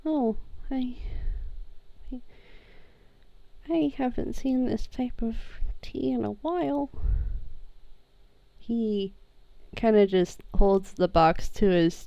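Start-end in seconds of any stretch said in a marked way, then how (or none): 0.0-6.9 very soft, haltingly moved voice; hushed, halting. Acted. Sammy sees tea he missed, hushed and halting. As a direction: barely get it out, with long pauses.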